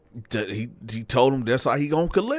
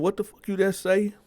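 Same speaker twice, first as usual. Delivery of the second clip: high-pitched, affronted demand; aggressive challenge; 11 semitones higher than usual. Retold or imitated. Bo answering the accusation, aggressive challenge. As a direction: challenge him to repeat it. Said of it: "in a real aggressive tone".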